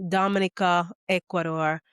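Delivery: usual speaking voice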